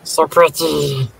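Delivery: slow drawn-out creepy "So pretty"; creepy little voice. Retold or imitated. Repeating the creepy line for friends, slow and eerie. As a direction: savour it like a creepy doll.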